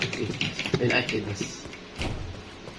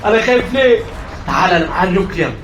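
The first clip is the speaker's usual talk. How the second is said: higher, louder frantic calls; nervous shouting; 7 semitones higher, 6 dB louder than usual. Real, not acted. Gamer facing the approaching enemy, nervous shouting. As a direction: shout at it to come while half scared.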